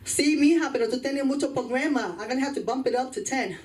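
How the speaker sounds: a hint of a Puerto Rican genie's accent. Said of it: "it was a Puerto Rican genie".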